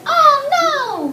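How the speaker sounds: high, loud scream. Shown as a demonstration. Teacher models Ryan's line for class, screamed. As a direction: scream it out, stretched long. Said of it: "Ryan screamed".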